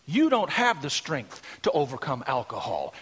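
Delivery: usual speaking voice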